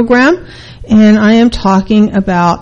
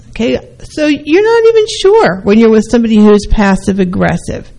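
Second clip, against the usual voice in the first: ordinary talk, nothing marked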